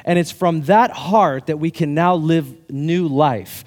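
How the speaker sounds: slow, weighty, deliberate; slow emphatic preaching. Real, not acted. Pastor driving home the gospel, slow and deliberate. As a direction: lay each word down slowly. Preaching the key point slowly.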